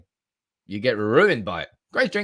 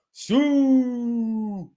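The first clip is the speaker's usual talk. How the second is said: mock Siuu chant; 11 semitones higher, 3 dB louder than usual. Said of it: "seventy K shouting Sue".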